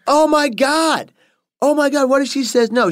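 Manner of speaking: mock panic. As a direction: retell his own freaking out. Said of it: "I was like".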